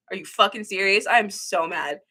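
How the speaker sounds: normal talking voice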